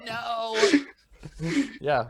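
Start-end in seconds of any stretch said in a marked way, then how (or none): 0.1-0.6 tiny, high, softened goblin wail; small mock-tragic cry, as if from far away; Jerry. Goblin mourning destroyed torches, crying out softly. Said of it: "a goblin named Jerry in a distant land takes a single tear".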